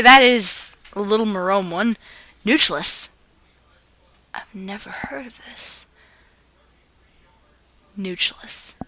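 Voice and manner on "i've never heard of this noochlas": whisper. Real, not acted. Puzzled aside over a strange title.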